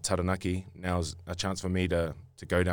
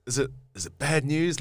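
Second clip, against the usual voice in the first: worried wife; 8 semitones higher, 4 dB louder than usual. Retold or imitated. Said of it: "She's like".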